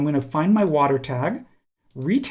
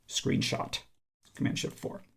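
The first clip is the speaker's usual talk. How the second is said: hushed, almost whispered aside; under the breath; 12 dB quieter than usual. Real, not acted. Presenter talking himself through a screenshot shortcut, under the breath. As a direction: say it very softly, half to yourself. Muttering the shortcut while doing it.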